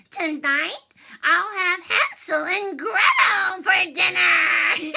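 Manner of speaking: loud, high gloating witch. Acted. Witch boasting about eating the children, loud high gloat. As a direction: crow it out like a greedy witch.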